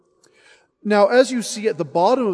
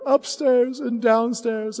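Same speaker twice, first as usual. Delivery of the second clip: upstairs and downstairs higher and slow; as tender little Billy; child's voice; 7 semitones higher, slower than usual. Acted. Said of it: "he says".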